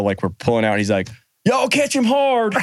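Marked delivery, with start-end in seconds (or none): 1.4-2.6 high, slow Southern holler; mock yell. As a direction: call it out high and drawn out. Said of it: "I hear him yell".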